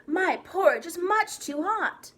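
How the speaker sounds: loud, slow playful bear complaint; lightly voiced Papa Bear, big and emphatic. A narrator's reading. Albert pretending to be Papa Bear at porridge, booming complaint. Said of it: "in a deep Papa Bear voice".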